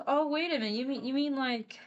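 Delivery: high-pitched character voice, taken aback; dawning realization. Acted. Scientist recognizing the kids' surname, high-pitched dawning realization. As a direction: raise the pitch as it dawns on her.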